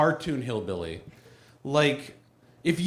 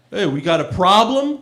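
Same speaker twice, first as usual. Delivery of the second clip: louder challenge from a New York tough; 3 semitones higher, 7 dB louder than usual. Acted. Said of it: "New York redneck for".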